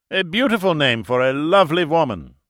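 put-on high, loud, accented flattery; gallant. A narrator's reading. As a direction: pay court like a florid German gentleman. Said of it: "he said gallantly".

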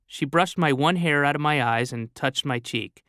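plain reading voice

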